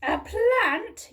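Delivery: high, loud shocked cry; horrified yell. A narrator's reading. Mother dinosaur yelling in horror at her son. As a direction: shriek it in disbelief. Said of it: "yelled Mum in horror".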